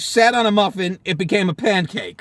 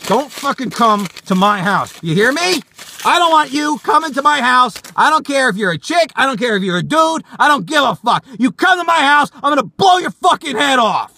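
raised, higher-pitched angry rant; furious warning; 5 semitones higher, 3 dB louder than usual. Acted. Man threatening his stalker away, furious. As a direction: lay down a threat, building in heat.

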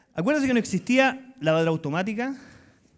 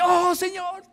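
high rapturous outcry; passionate prayer; 12 semitones higher, much slower than usual. Retold or imitated. Preacher imitating his old passionate praying, high. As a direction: swoon toward heaven. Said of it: "yo me ponía así".

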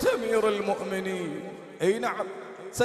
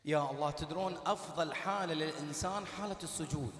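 lower, much softer devotional voice; soft prayer guidance; 7 semitones lower, 11 dB quieter than usual. Acted. Preacher guiding mourners into prostration, speaking low and soft. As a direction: ease the room gently into prayer.

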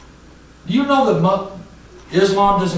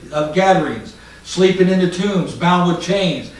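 usual speaking voice

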